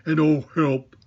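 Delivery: muffled Pooh. A narrator's reading. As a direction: call for help with your head stuck in a jar. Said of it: "he said inside the jar".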